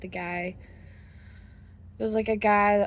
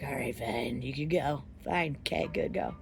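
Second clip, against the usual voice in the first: grudging bouncer; 7 semitones lower, 4 dB quieter than usual. Retold or imitated. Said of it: "And he was like".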